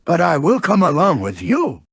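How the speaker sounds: lightly voiced bouncy tiger; Tigger. Tiger greets his friend on a bouncing day, lightly voiced character. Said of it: "said Tigger".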